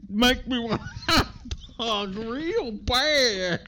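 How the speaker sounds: high, loud caricature with very stretched words; hammed-up impression breaking into a laugh; Jennifer Coolidge. Podcaster quoting the actress for laughs, high drawn-out caricature. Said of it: "And Jennifer Coolidge says".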